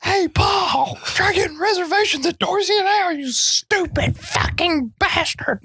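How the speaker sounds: high, strained movie-killer impression, slowing down on the insult; American Psycho quote. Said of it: "American Psycho".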